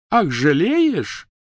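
high, loud, very drawn-out mockery; slow, piercing incredulous jeer; scornful outburst. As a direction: throw it back with derision. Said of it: "Гермиона пронзительно расхохоталась".

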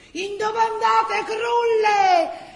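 high, loud, slow call out; mock-stern shout with a laugh in it; shouted warning. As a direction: yell it at the women from the trees. Men shouting warning at night.

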